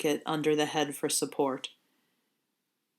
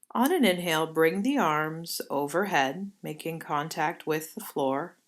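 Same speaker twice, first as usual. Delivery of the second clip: guided meditation. Acted. Leads yoga nidra movement.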